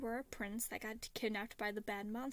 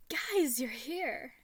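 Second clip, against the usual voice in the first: put-on loud, higher happy child call; excited greeting; 3 semitones higher, 7 dB louder, much slower than usual. A narrator's reading. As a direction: shout it, delighted. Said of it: "Ronan grinned widely".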